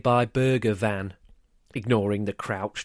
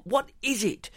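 exasperated howl; 12 semitones higher than usual. A narrator's reading. Mum abandoning the groceries to John, exasperated howl. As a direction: wail it high, at the end of your patience. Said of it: "she howled".

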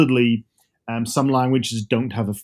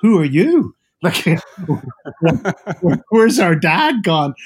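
higher, louder mock kids, laughing through it; kids asking, laughing; 4 semitones higher, 5 dB louder than usual. Kids asking their dad where their real dad went, higher and laughing. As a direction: play the baffled kids, giggling. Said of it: "My kids are looking at me just going".

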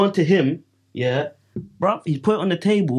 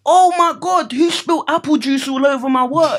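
high, loud, upset teacher; mock the teacher shrieking over her work; shrill teacher impression; 11 semitones higher, 5 dB louder than usual. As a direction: pupil mocks the supply teacher finding her wet work, high and loud. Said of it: "she goes".

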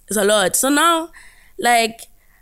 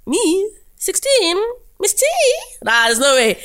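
disbelief; 9 semitones higher than usual. Retold or imitated. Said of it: "I was like".

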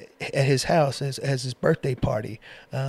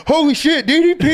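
higher and louder, laughing mock freak-out; excited brother; 14 semitones higher, 9 dB louder than usual. Retelling his brother seeing DDP on FaceTime, laughing and much higher. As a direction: blurt it out through laughter like a starstruck fan. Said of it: "And he's like".

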